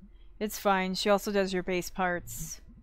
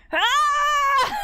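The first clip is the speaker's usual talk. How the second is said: high piercing excited scream; delighted shriek; 5 dB louder than usual. Real, not acted. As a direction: let out a joyful shriek. Shrieking at reunion.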